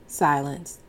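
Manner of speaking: ordinary delivery, nothing marked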